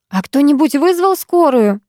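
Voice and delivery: put-on girl calling out to the crowd for an ambulance; called out. A narrator's reading. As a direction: shout it over the crowd on reflex. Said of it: "выкрикнула я на автомате".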